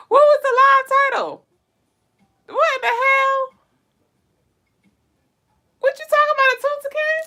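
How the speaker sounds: high incredulous what-are-you-talking-about; baffled. Real, not acted. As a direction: react in total confusion. Baffled reaction to viewer concern.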